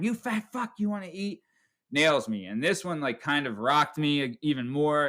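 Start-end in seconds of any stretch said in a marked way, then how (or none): 0.0-1.4 higher put-on voice, mock threatening; imitating dad's taunt. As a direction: sneer the taunt up high like a bully. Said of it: "Third time".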